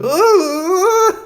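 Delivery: very high, loud squealing "ooh" with a laugh; laughing falsetto yelp; high-pitched yelp. Shown as a demonstration. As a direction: show how your voice shoots up when you really yell. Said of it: "I go".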